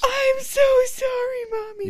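high, slow, sobbing little boy imitation; mock-wail an apology like a toddler; imitating crying kid. Mom imitating her son apologising after a swat, high slow mock sobbing.

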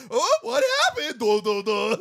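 high, chirpy cartoon-girl voice; cartoon Lizzie. Lizzie's animated version reacting to her own body, cartoon voice. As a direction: squeak it out like an animated sidekick. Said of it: "her animated version is still like".

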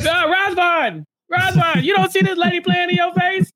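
high, hammed-up holler at Razvan; pleading, high-pitched shout to wake him up; exasperated yell. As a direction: call him out in mock disbelief. Acted exasperated call to Razvan.

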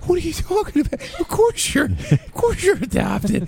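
put-on very high, gleeful Maryland-accented taunt; mock a kid's Maryland accent, squealing high and stretching the end; hammed-up incredulous Maryland-accented jeer; Maryland bully, barely contained. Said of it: "He can, like, barely contain himself".

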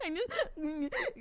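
high, gasping imitation of crying; mock sobbing. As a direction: choke it out as if hyperventilating. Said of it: "hyperventilating thing".

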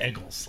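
"Eggles", first vowel as in egg; Philly pronunciation. Retold or imitated. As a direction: imitate the family's short-e "Eggles" for Eagles. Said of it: "They always call them the Eggles".

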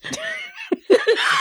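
high-pitched shriek of laughter; high squealing laugh. Real, not acted. Reacts to "just say chest.

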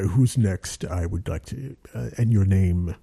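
low, measured voice of authority; low-pitched, James Earl Jones-style delivery; booming director voice. Retold or imitated. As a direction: imitate a deep-voiced stage director. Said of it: "in his booming voice".

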